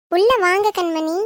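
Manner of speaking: ordinary delivery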